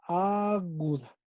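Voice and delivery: "aguda" despacio y más suave, alargando la A; dictado lento. Shown as a demonstration. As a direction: dicta "aguda" partido, a-guda. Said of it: "Entonces póngale por ahí". ("aguda" alargado y bajito, como para que lo escriban)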